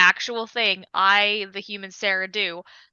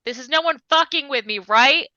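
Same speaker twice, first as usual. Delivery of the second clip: scared calling out; 5 semitones higher than usual. Acted. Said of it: "She's like".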